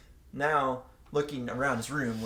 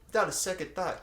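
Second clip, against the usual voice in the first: usual speaking voice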